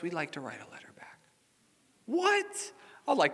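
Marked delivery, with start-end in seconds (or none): 0.0-1.2 whispered quote. Retold or imitated. Mail carrier quote, unvoiced. 2.1-2.7 high startled what; surprised what. Real, not acted. Pastor hearing the mail carrier's news, surprised.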